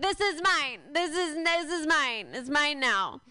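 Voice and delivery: a mock drunk possessive girl. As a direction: grab it like a drunk partygoer. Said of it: "and she's like".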